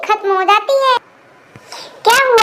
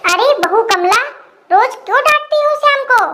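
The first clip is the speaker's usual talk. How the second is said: higher, lightly put-on auntie voice; a neighbourhood aunt chiding the bride; old aunt voice; 4 semitones higher than usual. Aunt scolds daughter-in-law.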